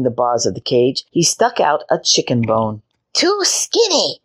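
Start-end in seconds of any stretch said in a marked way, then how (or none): 3.1-4.2 high, slow witch shout. A narrator's reading. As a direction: screech it like a wicked witch. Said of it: "shouted the witch".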